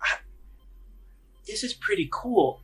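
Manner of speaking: put-on a girl judging her doll's new outfit; halfling girl. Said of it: "and she's like".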